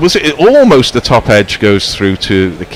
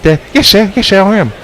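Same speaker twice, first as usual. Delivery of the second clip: higher, cheeky mock cockney lad, imitated; Artful Dodger voice; 9 semitones higher, faster than usual. Commentator imitates young bowler answering captain, mock cockney. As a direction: play a deferential street urchin saying yes sir. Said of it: "made him sound more like the Artful Dodger".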